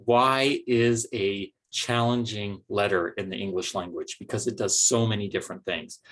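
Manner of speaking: usual speaking voice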